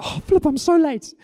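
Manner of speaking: a little meltdown at being late; panicked. Retold or imitated. Person in the queue realizing they are late, panicking. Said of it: "And then you go".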